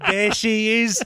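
Cockney chimney sweep voice; hammy old London street accent. Pretend.

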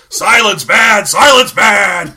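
slow, drawn-out zombie outburst; Robert Altman as zombie. Acted. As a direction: bellow the complaint like an undead director. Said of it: "zombie Robert Altman".

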